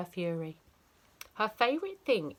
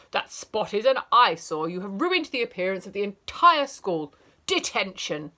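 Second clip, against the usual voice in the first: loud, outraged scold; furious teacher scolding a spotty child; 8 dB louder than usual. Acted. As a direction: tear into the child.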